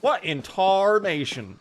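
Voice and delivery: slow, high Southern drawl; Southern accent. Shown as a demonstration. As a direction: draw it out in a hammed-up Southern accent. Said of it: "That might not have been very Southern".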